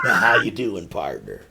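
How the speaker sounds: imitating a low grizzled cowboy; deep mock Western voice. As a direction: mimic the grizzled greeting, dropping very low at the end. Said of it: "I would actually be more grizzled".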